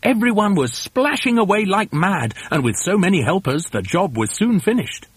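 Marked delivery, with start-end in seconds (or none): none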